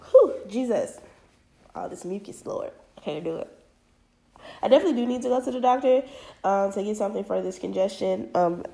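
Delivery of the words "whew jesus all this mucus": ordinary talk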